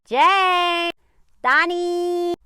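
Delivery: playful mock summons; mock calling out. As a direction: yell the names like a scolding parent. Calling him by mock names.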